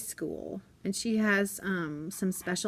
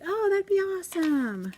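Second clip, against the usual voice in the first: grateful friend; 11 semitones higher, 4 dB louder than usual. Retold or imitated. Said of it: "she's like".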